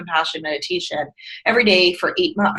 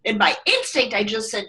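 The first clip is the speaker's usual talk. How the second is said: ordinary talk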